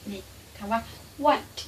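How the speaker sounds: ordinary talk, nothing marked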